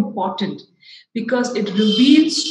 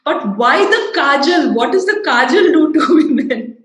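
higher, louder exasperated puzzling, breaking into a laugh; baffled wondering; 7 semitones higher, 9 dB louder than usual. Retold or imitated. Teacher recalling sleepless nights over women's practices, baffled wondering. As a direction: puzzle it out loudly, amused at the question. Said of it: "could not sleep wondering".

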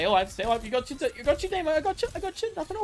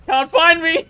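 louder, higher gloating sing-song; loud teasing taunt; 5 semitones higher, 9 dB louder than usual. Pretend. Gamer taunting enemies who can't find him, loud gloating.

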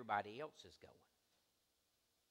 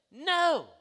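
loud, high-pitched motherly refusal; mother refusing; 14 semitones higher, 15 dB louder than usual. Retold or imitated. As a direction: shut the kid down loudly as mom. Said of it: "my mother would say".

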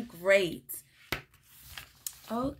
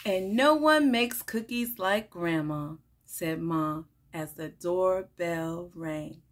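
animated picture-book read-aloud with lively character lines; reading to children; 4 semitones lower, slower than usual. Acted. Teacher reading a picture book to children, bright and animated. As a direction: read it like story time for little kids.

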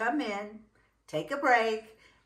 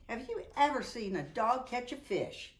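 plain reading voice

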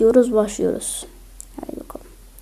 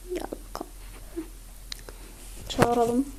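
whisper; 12 dB quieter than usual. Real, not acted. Whispering while sneaking in game.

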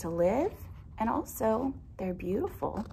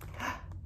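sharp soft intake of breath; startled gasp; 12 dB quieter than usual. Acted. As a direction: gasp as if waking suddenly. Man awakes with a start.

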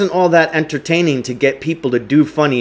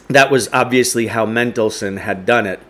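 ordinary delivery, nothing marked

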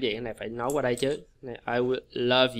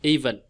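"Even" with strong EE, then falling; teacher repeating "Even" as a model; first-syllable stress. Shown as a demonstration. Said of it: "Nhấn âm một là ý nè".

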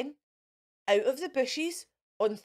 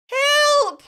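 very high, loud desperate "Help!"; witch's cry for help; 13 semitones higher, 10 dB louder than usual. A narrator's reading. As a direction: yell for help in panic. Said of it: "cried the witch".

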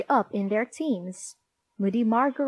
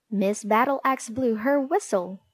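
ordinary delivery

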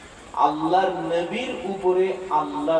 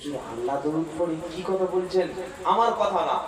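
normal talking voice

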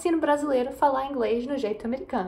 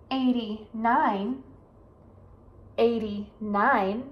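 "thirty-one" to "eighty-nine" spoken very slowly; slow, careful numbers; much slower than usual. Shown as a demonstration. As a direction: stretch each number out for learners. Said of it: "pra vocês ver e praticar".

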